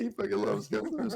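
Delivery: mock Ben voice. Mocking Ben's calzone love.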